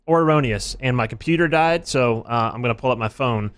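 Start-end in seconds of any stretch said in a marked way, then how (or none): 0.0-0.8 game show call. Acted. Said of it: "game title call".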